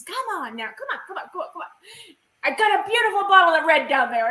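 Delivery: high, playful coaxing, fast at the end; coaxing host. Retold or imitated. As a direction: lure them down with a wink. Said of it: "he's trying to coax us".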